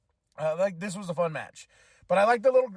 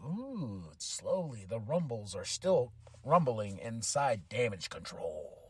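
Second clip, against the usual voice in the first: ominous hint; 8 semitones lower, 6 dB quieter, slower than usual. Retold or imitated. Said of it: "And I was like".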